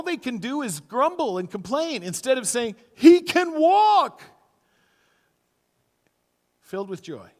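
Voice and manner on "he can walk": very high, loud, slow cry; amazed exclamation. Retold or imitated. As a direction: shout the wonder of it. Preacher exclaims the obvious miracle.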